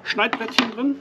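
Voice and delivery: ordinary talk, nothing marked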